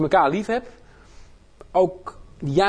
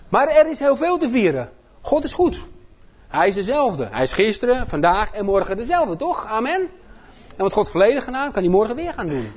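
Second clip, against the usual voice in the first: preaching; 7 semitones higher than usual. Acted. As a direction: lift the room like a revival preacher. Preaches at full force.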